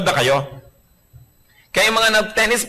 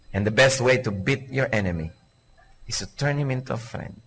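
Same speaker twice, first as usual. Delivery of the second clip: soft repeated maxim; 6 semitones lower, 7 dB quieter than usual. Acted. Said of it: "repeated maxim, softer".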